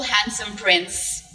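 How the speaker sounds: ordinary talk, nothing marked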